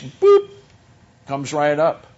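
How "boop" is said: high, loud "boop"; a sudden high-pitched electronic boop; imitated beep. Preacher mimicking a car's map system popping up, imitated beep.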